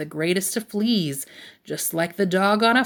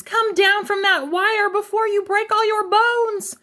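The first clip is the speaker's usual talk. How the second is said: very high worried-mother cry; alarmed storybook mother calling up; wailing mama; 13 semitones higher, 3 dB louder than usual. A narrator's reading. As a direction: plead in a high, put-on mama wail. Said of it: "wailed Mama Junebug Jones".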